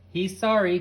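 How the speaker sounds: loud, high call across; yelled. A narrator's reading. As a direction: yell over to the neighbor. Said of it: "Carrie yelled".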